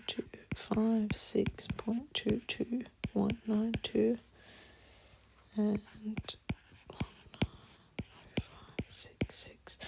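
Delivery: half-whispered numbers read off the calculator; muttering numbers. Real, not acted. Teacher reading off the calculator to herself, whispered mutter. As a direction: mutter the digits under your breath.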